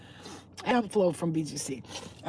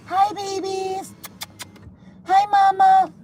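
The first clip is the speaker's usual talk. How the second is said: high singsong greeting, growing louder; 10 semitones higher, 6 dB louder, slower than usual. Real, not acted. Cat lover greeting strays outside, high singsong call. Calling to the cats.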